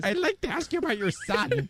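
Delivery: high, put-on nerd agent voice; nerdy IRS agent. Nerdy agent asking a father about his son, put-on high voice. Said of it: "a famous nerd disguise".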